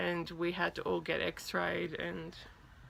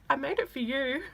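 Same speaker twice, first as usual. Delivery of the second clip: earnest fan; 8 semitones higher than usual. Retold or imitated. Fan blurts it again, self-mocking.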